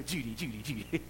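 lightly voiced comic impression, rattled off; soft, quick catchphrase imitation; Goober. Preacher likening his fast repeats to a comic catchphrase, light impression. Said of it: "Kinda like Goober, you know".

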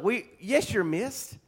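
ordinary talk, nothing marked

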